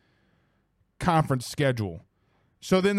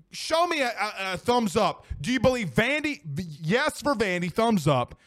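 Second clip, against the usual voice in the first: normal talking voice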